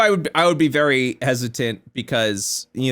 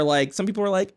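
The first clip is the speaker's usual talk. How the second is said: ordinary talk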